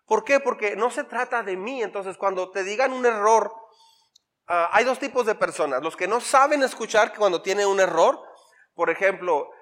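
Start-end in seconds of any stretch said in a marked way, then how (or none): none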